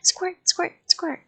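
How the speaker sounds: high playful "squirt, squirt, squirt". Acted. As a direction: squeak out each squirt like a toy. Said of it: "I said".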